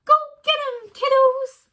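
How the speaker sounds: very high, loud meerkat shout. A narrator's reading. Meerkat Kevin cheering the running kids, shouting. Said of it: "shouted one last time".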